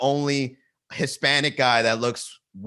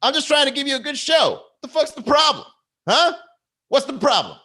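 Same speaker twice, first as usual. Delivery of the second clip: very high, loud mock outrage; whine-shout it high at the heckler; worked-up comic character; 13 semitones higher, 4 dB louder than usual. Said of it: "this character that I developed".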